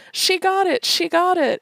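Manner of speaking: high, loud happy shouting; mock jubilant shout; excited cheer. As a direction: cheer like your wife just won. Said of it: "And Harry says".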